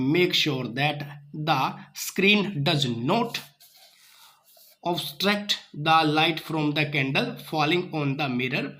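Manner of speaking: very slow, word by word reading aloud; slow textbook reading. Acted. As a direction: read each word out slowly for the class. Reads the textbook aloud slowly. (धीमे-धीमे किताब से पढ़ना)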